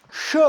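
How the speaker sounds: Sh as "shuh", one syllable; isolated "shuh" for Sh; unvoiced consonant demo. Shown as a demonstration. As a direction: sound out Sh with a short vowel.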